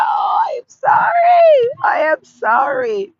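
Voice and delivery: high, loud, slowed mock wail of sorry; mock wailing apology. To viewers stuck in cold Minnesota, a mock wailing apology. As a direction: wail the apology as a joke lament.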